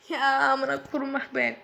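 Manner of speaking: ordinary delivery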